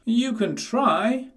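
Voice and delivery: high, slow, defiant piglet voice; a cheeky little pig daring the wolf. A narrator's reading. Percy daring the wolf from his stone house, high. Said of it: "said Percy".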